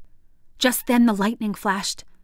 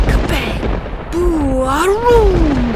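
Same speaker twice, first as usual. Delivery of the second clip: rising loud booms; booming thunder; 7 semitones higher, 7 dB louder, much slower than usual. A narrator's reading. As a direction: roar it out like thunder. Thunder sound effect.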